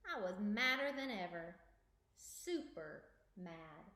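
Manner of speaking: lively storybook narration; reading to children. Acted. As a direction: read it aloud for kids. Reads picture book aloud.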